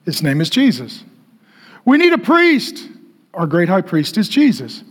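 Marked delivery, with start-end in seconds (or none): 1.8-2.9 put-on plaintive voice, pitched way up; anxious religious people. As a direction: mimic people fretting in a raised pitch. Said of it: "People are like".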